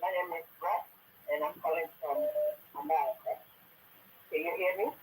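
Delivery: quiet, faint phone voice; talking softly, hard to hear; barely audible. Real, not acted. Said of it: "your voice is very low".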